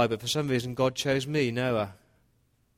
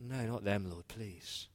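quiet plea; 10 dB quieter, slower than usual. Retold or imitated. Noah begs God for family.

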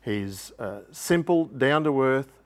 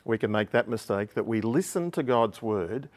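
usual speaking voice

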